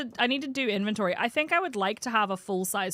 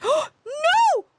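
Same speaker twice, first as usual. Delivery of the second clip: gasping, high, loud no; sudden high yelp of disbelief; shocked cry; 9 dB louder than usual. Real, not acted. Discovers his car destroyed.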